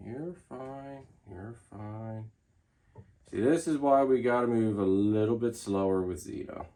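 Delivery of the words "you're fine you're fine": soft, hushed reassurance; calm a nervous pet quietly; soothing murmur. Real, not acted. Soothing the snake softly.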